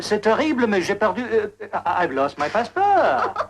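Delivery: put-on theatrical French accent, stretched ending. Traveller rehearsing lost-passport story for gendarme, French accent. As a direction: play a flustered Frenchman who speaks halting English. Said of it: "That is a French accent".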